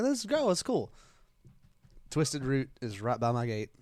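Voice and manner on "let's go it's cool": high-pitched, upbeat self-quote; retold excitement. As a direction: recall your own delight, pitched way up. Retold glee at no TSA line.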